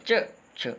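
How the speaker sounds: "च" crisp and unaspirated, then "छ" with a strong breathy puff; teacher sets "च" beside an aspirated "छ"; contrasting च and छ. Shown as a demonstration. Hindi teacher models "च" and "छ" for the class, clearly and deliberately. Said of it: "इन शब्दों के उच्चारण पर ध्यान दें".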